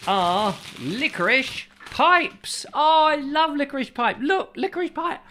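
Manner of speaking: squeaky sketch-character voice; silly character voice. Acted. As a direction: play a giddy sketch character delighting in his pipe. Sherlock sketch with licorice pipes.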